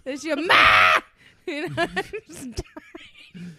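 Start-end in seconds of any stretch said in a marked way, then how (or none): 0.4-1.0 very loud mimicked shout; yelling for mother. Imitating the lady at the office, shouting. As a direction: belt it out for Ma. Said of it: "was yelling at her mom".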